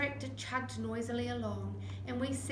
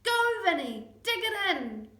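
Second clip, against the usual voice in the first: high, loud cheering; 9 semitones higher, 9 dB louder than usual. Acted. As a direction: roar him on. Cheering a runner on.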